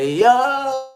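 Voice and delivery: high, loud Jamaican "Yo" call; Jamaican accent. Retold or imitated. Imitating a Jamaican heard anywhere around the globe, Jamaican accent. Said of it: "I can distinguish Jamaican accent".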